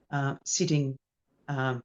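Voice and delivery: ordinary talk, nothing marked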